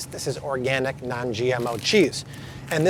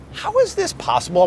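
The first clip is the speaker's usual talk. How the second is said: high, incredulous question; cartoonish disbelief; amazed. Real, not acted. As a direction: sound truly astonished at the price gap. Marvelling at big price gaps.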